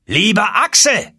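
hoch und gedehnt gerufen; Ruf. A narrator's reading. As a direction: der Onkel ruft durch die Tür nach Axel. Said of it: "Aber er rief immer weiter". (langgezogener Ruf)

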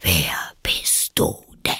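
low, contemptuous whisper; scornful caterpillar. A narrator's reading. The caterpillar scornfully asks Alice again. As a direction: whisper it with disdain. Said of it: "verächtlich".

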